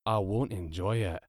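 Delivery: subdued, gloomy drone; resigned robot misery; Marvin. A narrator's reading. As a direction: agree with hopeless reluctance. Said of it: "Its voice was low and hopeless".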